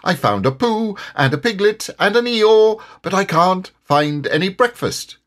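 a boastful bouncer with an empty stomach; bouncy Tigger. Acted. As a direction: Tigger proud of his finds but hungry. Tigger voice in story.

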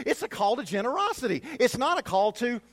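loud, high preacher's call to the flock; full-force preaching. Acted. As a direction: preach it out to the congregation. Pastor preaching loudly to congregation.

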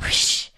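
one voiceless mouth-made swish; imitated whoosh noise. Finishing the karate fight recap, imitated whoosh. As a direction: toss in a hushed "whsh" effect. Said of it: "I just love making that noise".

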